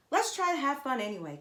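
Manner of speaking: put-on bright, quick, lifted kid voice; cheerful encouraging. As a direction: cheer a sad friend up, quickly. Kenny cheering Zoe up.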